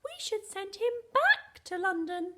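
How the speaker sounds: high, loud silly voice; funny voice. Pretend. Teacher playing with voices for pupils, high and loud. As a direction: shout it high, punching BACK. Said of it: "Try and say it in a funny voice".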